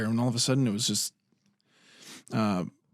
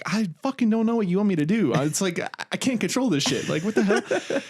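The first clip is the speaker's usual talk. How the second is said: high, rushed, helpless exasperation; exasperated retort; 8 semitones higher, faster than usual. Retold or imitated. Driver to pissed girlfriend, exasperated. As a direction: protest in a pitched-up retold rant.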